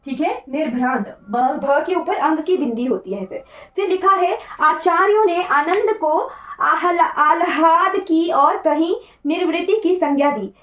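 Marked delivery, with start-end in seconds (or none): none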